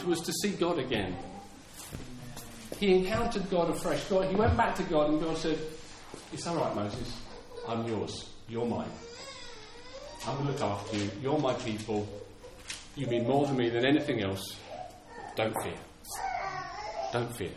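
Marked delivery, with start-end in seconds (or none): 6.3-17.5 low, soft, spaced-out comforting; tender reassurance. Acted. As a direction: speak as God soothing a frightened servant. God acted reassuring Moses.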